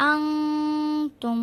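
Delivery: আনতুম with ন nasalised like anusvar, held; slow, nasal hum on ন in আনতুম; ghunna demo. Shown as a demonstration. Teacher demonstrates the nasal ghunna, slow. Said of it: "অনুসারের মতো উচ্চারণ করতে হবে".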